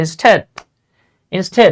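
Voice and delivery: "instead" as in-sted; dictionary pronunciation. Shown as a demonstration. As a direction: say "instead" with a short e. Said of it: "দেখছেন কি বলছে?".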